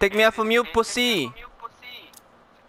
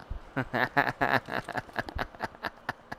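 soft, drawn-out chuckling; quiet laughter; 5 semitones lower, 14 dB quieter than usual. Real, not acted. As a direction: laugh low to yourself. Laughing at his own joke.

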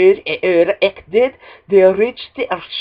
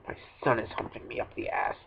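breathy whispered complaint; whispering; 8 semitones lower, 16 dB quieter, faster than usual. Real, not acted. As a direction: mutter under the breath. Muttered complaint.

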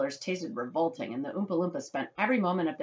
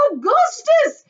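very high, loud yell; shouting; 11 dB louder, much slower than usual. A narrator's reading. Mother calling her son, shouting. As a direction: shriek the name at full volume. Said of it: "shouted Missus Gloop".

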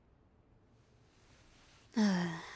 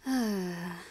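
long exasperated sigh; weary sigh. Acted. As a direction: sigh at a tiresome opponent. Vampire tired of hunter's protests.

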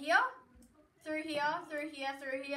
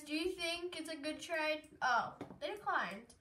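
usual speaking voice

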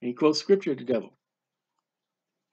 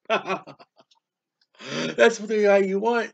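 slow, mocking laugh through the words; much slower than usual. Real, not acted. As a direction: laugh at their bad choice. Mocking laugh at listeners' choice.

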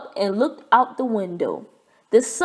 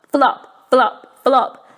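flopping sounds; 2 semitones higher, 6 dB louder, slower than usual. A narrator's reading. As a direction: bounce each flop like a clumsy frog.

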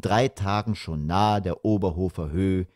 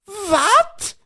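put-on very high, loud child's shriek; shrieked outcry; 5 dB louder than usual. A narrator's reading. Both children learning there is no TV, shrieking. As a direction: screech it in horrified disbelief. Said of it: "schrien beide Kinder gleichzeitig".

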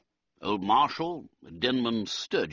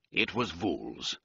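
usual speaking voice

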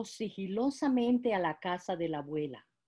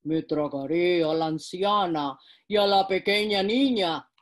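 wolf voice; 3 semitones lower, 5 dB louder than usual. Acted. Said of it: "pensó".

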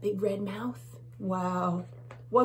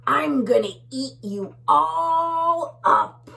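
slow, loud higher threat; bully bug; 7 semitones higher, 7 dB louder than usual. Acted. Bully bug threatening the tiny bugs, slow and loud. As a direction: threaten like a monster. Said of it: "says the big bad bully bug".

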